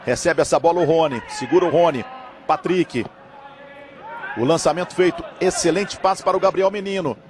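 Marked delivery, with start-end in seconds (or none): none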